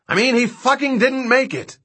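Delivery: high-pitched, exasperated yell; angry yell. Acted. Dodgson answers King's question about Bazleton, yelling angrily. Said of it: "Dodgson yelled".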